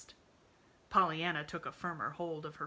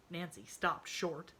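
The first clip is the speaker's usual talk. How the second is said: ordinary delivery, nothing marked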